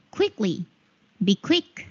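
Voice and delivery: ordinary talk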